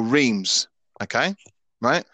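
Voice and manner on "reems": Reims said as English "reemz"; anglicised pronunciation. Shown as a demonstration. Host showing listeners how Brits name the team, Reims as "reemz". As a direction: make Reims rhyme with dreams. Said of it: "Many British people would call this team".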